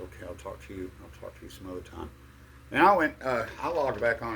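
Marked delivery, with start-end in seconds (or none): 0.0-2.1 very soft, low reply; hushed, retreating goodbye; subdued sign-off. Retold or imitated. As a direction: back out quietly, under your voice. Retelling his downcast retreat from the crowd.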